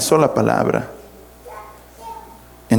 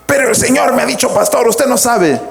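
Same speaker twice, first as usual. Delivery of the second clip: high, hurried indignant objection; protesting church member; 9 semitones higher, faster than usual. Acted. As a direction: mimic a worked-up member arguing with the pastor.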